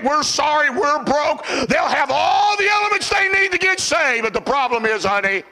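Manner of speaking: pleading phrases piled up in preacher cadence; fervent revival delivery; impassioned preaching. Acted. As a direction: build to a high shout, then drop away. Preaching, pleading in sinners' words.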